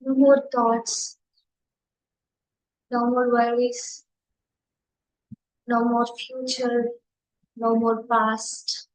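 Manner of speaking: measured meditation guide with long silences between phrases; unhurried meditation leading, spacious pauses; guided meditation. Real, not acted. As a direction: guide listeners gently into stillness, one short phrase at a time.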